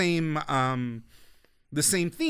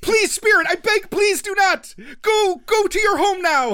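loud, high-pitched mock-heroic pleading; Ashitaka imploring voice; 5 dB louder than usual. Hero urging the spirit to go home, imploring. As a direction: beg the spirit, hammed up and high. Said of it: "that Ashitaka imploring voice".

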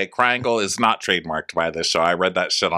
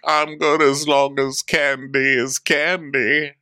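slow, higher mock husband voice; Ray; 7 semitones higher, slower than usual. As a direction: say it slowly as an easygoing husband. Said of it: "And he's like".

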